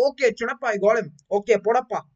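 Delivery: put-on barking orders in South Indian accent. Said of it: "he's like".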